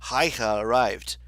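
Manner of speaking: plain reading voice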